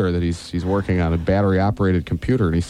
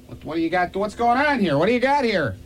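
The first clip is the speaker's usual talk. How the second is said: squeaky flustered bus driver, much higher; high confused voice; 11 semitones higher, 4 dB quieter than usual. Acted. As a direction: play it as a panicked, high-pitched driver.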